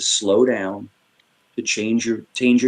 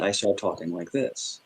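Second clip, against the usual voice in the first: much softer and lower; easy, settled, low-volume voice; not upset; 4 semitones lower, 11 dB quieter than usual. Shown as a demonstration. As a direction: drop to a calm, quiet register. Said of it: "if I'm normally not upset".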